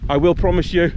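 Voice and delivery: normal talking voice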